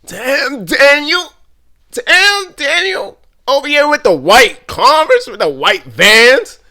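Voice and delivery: high, loud imitation of the viral catchphrase; Damn Daniel meme. Recalling an old viral video, hammed-up meme voice. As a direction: hype it up high, stretching out the end. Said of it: "y'all remember the".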